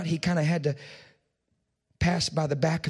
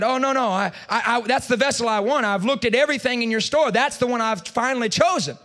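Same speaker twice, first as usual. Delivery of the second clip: higher, louder, a bit rushed; puzzled protest; 8 semitones higher, 5 dB louder than usual. A narrator's reading. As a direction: insist in disbelief, voice climbing.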